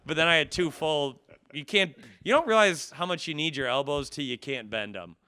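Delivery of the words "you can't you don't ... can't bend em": normal talking voice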